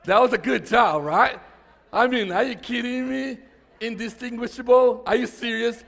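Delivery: loud teasing disbelief at the crowd; playful mock outrage. Real, not acted. Pastor teasing congregation about word.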